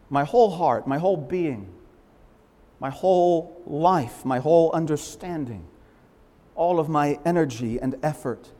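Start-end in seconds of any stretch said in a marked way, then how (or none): none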